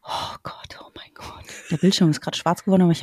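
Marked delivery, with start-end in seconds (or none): 0.0-1.5 alarmed whisper. Real, not acted. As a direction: whisper it in a small panic. Bildschirm schwarz geworden. (geflüstert)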